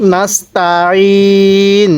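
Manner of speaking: নাস্তাইন with thin s and throat-pressed ain; careful tajweed demo. Shown as a demonstration. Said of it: "আইনটা উচ্চারণের সময় গলার মধ্যে চাপ দিয়ে পড়লে".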